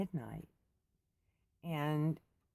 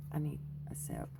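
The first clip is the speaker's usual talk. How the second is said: whisper; 5 dB quieter, faster than usual. Real, not acted. Aside before drinking coffee.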